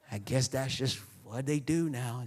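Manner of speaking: normal talking voice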